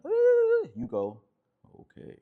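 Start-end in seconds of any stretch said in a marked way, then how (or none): 0.0-0.7 very high, loud mock squeal; high-pitched shriek. Upset woman going off at her man, high shriek. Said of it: "So if she's going". 1.8-2.2 very soft, settled answer; slowed-down calm reply. Shown as a demonstration. Man answering her outburst, slowed and calm. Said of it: "you slow your rate of speech all the way down".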